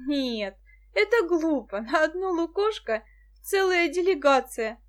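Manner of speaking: decisive objection. A narrator's reading. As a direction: put your foot down, emphatically. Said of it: "решительно сказала Муся". (решительно возражая)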